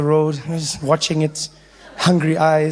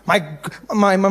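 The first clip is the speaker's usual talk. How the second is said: ordinary delivery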